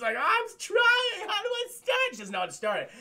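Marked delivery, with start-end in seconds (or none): 0.2-2.0 high, panicked falsetto; flustered high voice. Retold or imitated. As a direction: squeak it out in a panic. Flustered woman voiced in recap.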